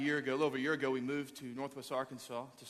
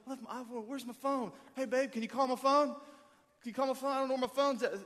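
high-pitched frantic fretting; comic, high-voiced pleading; mock panic; 10 semitones higher than usual. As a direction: play a flustered phone hunt, voice climbing. Acts out frantic search for phone.

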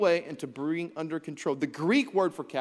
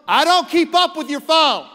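loud, high indignant reply; hammed-up irritated wife; exasperated spouse retort; 11 semitones higher, 11 dB louder than usual. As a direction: voice the annoyed spouse snapping back.

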